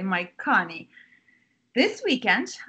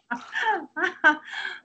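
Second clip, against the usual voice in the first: 1 mother's laugh; 8 semitones higher than usual. Acted. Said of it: "She laughs".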